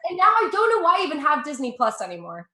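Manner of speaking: ordinary talk, nothing marked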